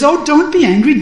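urgent high plea, don't be angry; pleading. Acted.

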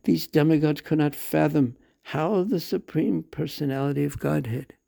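usual speaking voice

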